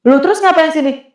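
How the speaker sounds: high, puzzled challenge; retold bewilderment, high-pitched; taken aback. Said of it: "Kaget, Pak".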